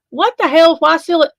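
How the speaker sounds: high, loud disbelief; outraged at the news; indignant outrage. Real, not acted. Outrage at sealed exhibit.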